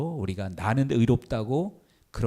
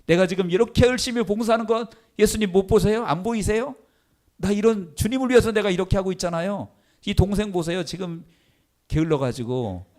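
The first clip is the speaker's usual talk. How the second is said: much higher, complaining sister; whiny complaining Martha; 8 semitones higher than usual. Retold or imitated. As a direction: plead to Jesus in a put-upon whine. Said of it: "자기의 의의를 드러내고 있는 거예요".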